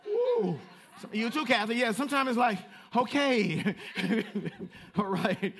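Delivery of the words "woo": very high, louder mock whoop; a playful whoop of joy; hammed-up cheery woo; excited woo. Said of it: "just like".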